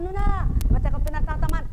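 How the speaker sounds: mock indignant protest; offended retort. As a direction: bristle as if insulted. Offended listener acted out.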